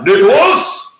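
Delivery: loud, high yell of a name; dad yelling up the staircase, loud and high. Retold or imitated. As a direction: call your son up the stairs. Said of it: "He's like".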